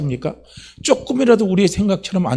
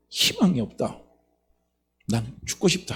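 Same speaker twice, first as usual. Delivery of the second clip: despairing; 2 semitones lower, 7 dB quieter than usual. Acted. Acts out despair, voice dropping away.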